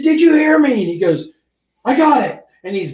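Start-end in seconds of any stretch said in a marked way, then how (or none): 0.0-0.7 higher and louder, barked at the rookie; sharp demand. Retold or imitated. Sergeant demanding to rookie's silence, loud bark. 1.8-2.4 quick reply. Retold or imitated. Rookie answers sergeant.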